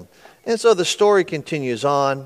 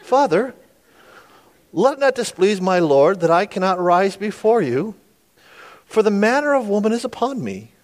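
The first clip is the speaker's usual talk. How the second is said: higher-pitched, mock British daughter; British accent; 6 semitones higher than usual. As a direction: plead politely in put-on British. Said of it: "with a British accent".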